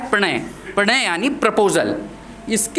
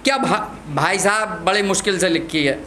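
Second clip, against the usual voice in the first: ordinary talk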